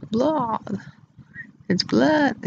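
"Vlad" drawn toward "Vlahd", soft and falling. Retold or imitated. Showing how he sounds, "Vlad" as a falling "Vlahd". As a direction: say "Vlad" with an open Dracula-like vowel. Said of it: "That's how he sounds".

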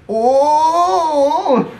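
high, slow mock outraged dad. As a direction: ham up a scolding dad. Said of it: "He's like".

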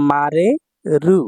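মারেরু drawn out slowly, syllable by syllable, rising at the end; slow teaching repeat of মারেরু. Shown as a demonstration. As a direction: stretch মারেরু so students catch each syllable.

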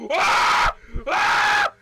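loud high scream; panicked scream. Real, not acted. Scream at monster attack.

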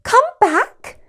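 high, loud cry after her floating sister. Acted. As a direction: call her back in alarm. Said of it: "cried Lucy".

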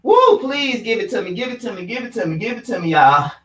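strained under effort; straining plea. Real, not acted. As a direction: push through the burn. Last seconds of plank.

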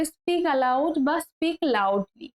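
drawn out slowly, word by word; slow, clear teacher's model; slow model sentence. Shown as a demonstration. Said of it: "teacher models slow English". (ধীরে স্পষ্ট করে বলা)

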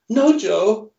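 breathy Marilyn act. Acted. Creature insists to Joe it has come back, high coaxing voice. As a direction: sell the comeback like a Hollywood siren. Creature pleads with the young man.

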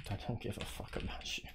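whispered, dismissive. Real, not acted. Streamer brushing off a player in a whisper. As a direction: breathe it out under your breath.

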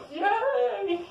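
high squealing yay with laughter; giggling cheer. Real, not acted.

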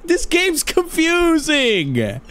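high, slow, drawn-out whine; frustrated whine. Acted. Player stuck on a confusing puzzle, mock whining. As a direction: wail it like a baffled kid, stretching the last word.

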